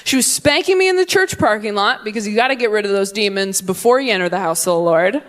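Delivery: ordinary talk, nothing marked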